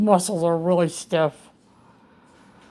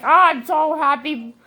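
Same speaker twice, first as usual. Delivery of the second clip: imitating delighted surgeon; 7 semitones higher than usual. Patient quoting the hugging surgeon, mock gushing delight. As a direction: gush like a proud doctor. Surgeon's happy praise voiced.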